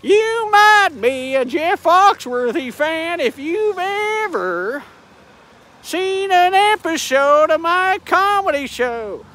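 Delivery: hint of Foxworthy, drawn out; Jeff Foxworthy impression. Vlogger mocking Jeff Foxworthy, slow Southern drawl. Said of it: "how you from South".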